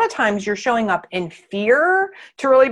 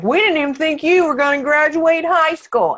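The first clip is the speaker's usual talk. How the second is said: a hint of dismissive parents, higher and louder; mock parents' put-down; 6 semitones higher, 4 dB louder than usual. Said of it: "my parents were like".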